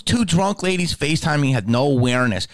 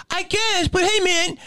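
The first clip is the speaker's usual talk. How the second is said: imitating a clueless fan, much higher; dopey nostalgic fan, pitched up. Fan ignoring the smell, high dopey voice.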